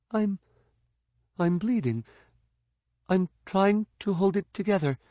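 high, strained hurt voice; struggling to stay calm; injured Tahiri. Acted.

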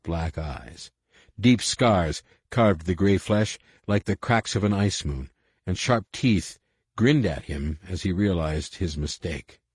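ordinary delivery

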